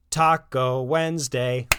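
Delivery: slow, loud call across the house; dad announcing taco night as he comes in; shouting. Acted. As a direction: call out the dinner news. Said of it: "Ron kept shouting".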